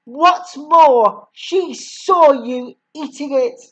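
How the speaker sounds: raging headmistress. A narrator's reading. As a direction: rant like a furious tyrant. Headmistress rants at the boy.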